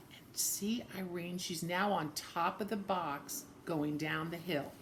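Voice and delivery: soft whisper pointing at the picture; hushed aside. Real, not acted. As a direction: lean in and whisper it.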